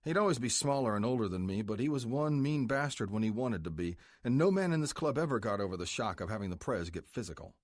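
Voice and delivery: ordinary talk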